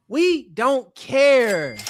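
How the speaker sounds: very slow and dragged, we don't care; taunting slow drawl at the critic; slow dismissive drawl. Real, not acted. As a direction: stretch every word out, unbothered. Streamer repeats dismissal, drawn out.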